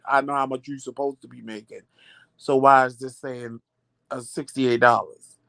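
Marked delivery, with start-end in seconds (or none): none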